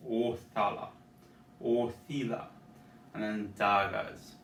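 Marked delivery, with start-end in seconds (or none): 0.1-0.9 Ohthala with a deep throaty O from the back of the throat. Shown as a demonstration. Teacher showing the back-of-throat vowel, deep O. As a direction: start Ohthala with a dark deep O. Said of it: "It's a deep O".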